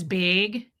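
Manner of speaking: Minnesotan BAYG, drawn out; slow long ay in Minnesotan bag; Debbie Zito. Retold or imitated. Host quoting her friend, Minnesotan accent. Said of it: "She says big".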